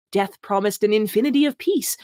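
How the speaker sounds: usual speaking voice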